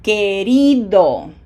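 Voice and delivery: querido with RI loud and stretched; slow, loud querido, leaning on the I; stress on the I. Shown as a demonstration. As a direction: punch the middle syllable: que-RI-do. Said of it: "the I gets it".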